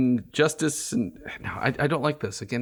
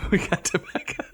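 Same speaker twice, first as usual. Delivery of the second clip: laughing; 6 semitones higher than usual. Real, not acted. Repeats the Kentucky motto laughing.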